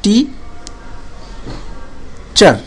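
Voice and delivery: টি, long pause, then চার; two separate syllables. Shown as a demonstration. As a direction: say টি and চার as isolated beats. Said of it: "দুইবার উচ্চারণ হচ্ছে".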